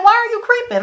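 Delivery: high-pitched incredulous question; incredulous challenge. Acted. Viewer confronting a character about creeping around, incredulous and high. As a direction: call them out, pitch shooting up high. Scolding a show character directly.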